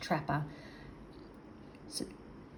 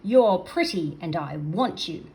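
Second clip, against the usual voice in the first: much higher, louder, slow furious roar; raging creature; 3 semitones higher, 8 dB louder, much slower than usual. Acted. Angry creature roars after the seal, loud and slow. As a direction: stamp and rage at the escaping seal. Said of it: "stomping his feet and raging".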